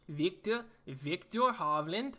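slow, fumbling fake Norwegian accent on the name; awkward Norwegian accent, rising at the end; bad Norwegian accent. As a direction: attempt a Norwegian accent again. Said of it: "That's terrible. I gotta stop trying that".

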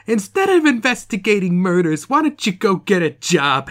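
high, nagging Italian wife voice; hammed-up Italian wife nagging; Italian accent. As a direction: scold him in a mock Italian accent. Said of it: "The woman you just did was Italian".

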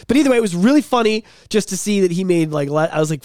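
usual speaking voice

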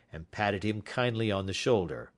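plain reading voice

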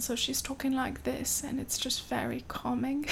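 soft, calming British accent; hushed put-on British voice, very soothing. Shown as a demonstration. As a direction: imitate a soft-spoken British lab technician. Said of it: "one of the technicians has a British accent".

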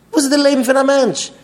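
high, loud rhetorical question; impassioned outcry. Acted. Speaker challenges someone quarrelling with parents, impassioned outcry.

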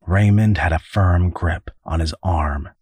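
plain reading voice

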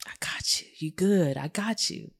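soft whisper, calm and kind; whispered reassurance. Retold or imitated. Husband whispering to calm his emotional wife. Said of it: "he's like".

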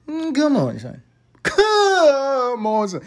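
high, incredulous come on son; mock disbelief. Scoffing at the casting, high incredulous come on son.

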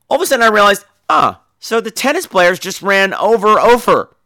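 ordinary talk, nothing marked

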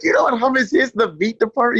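high, loud mock disbelief; incredulous. Comic asks the clerk about hummus, high incredulous. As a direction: pitch it way up in mock astonishment. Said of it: "I was like".

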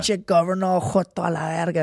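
high mocking Spanish taunt; friends making fun. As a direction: jeer like your buddies in Spanish. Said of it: "they're all making fun of me".

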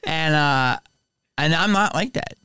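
ordinary talk

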